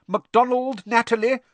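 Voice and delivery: loud, high roll call; calling out names. A narrator's reading. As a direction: summon a pupil across the hall. Teacher calls out name.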